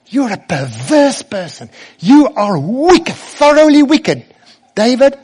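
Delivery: high, loud denunciation; angry accusation. Acted. Cursing man denounces the fleeing king, loud and high accusing cry. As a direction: build to a high, loud condemnation. Voicing Shimei cursing David.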